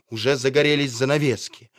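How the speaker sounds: usual speaking voice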